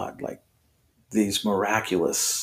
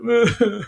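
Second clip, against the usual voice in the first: high, loud mock sobbing; badly faked boo-hoo, pitched way up; imitated fake crying; 14 semitones higher, 5 dB louder than usual. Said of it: "doing the classic, like".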